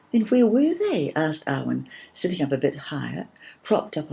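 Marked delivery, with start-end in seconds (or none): 0.1-1.1 high, eager question; a little girl anxious to know; anxious child. A narrator's reading. Anxious girl asks her storyteller sister, higher and louder. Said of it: "her little face anxious".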